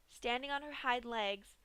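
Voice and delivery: ordinary delivery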